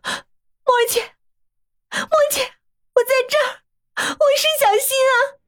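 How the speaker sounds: high, crying, breaking voice; tearful outcry. A narrator's reading. Bai Xin cries out to his lost friend, tearfully. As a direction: blurt it out through tears. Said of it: "他脱口而出".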